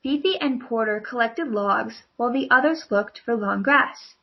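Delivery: ordinary delivery, nothing marked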